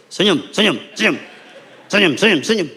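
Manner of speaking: loud, high-pitched repeated commands; acted nagging photo-time command; urgent smile orders. Family posed and ordered to smile.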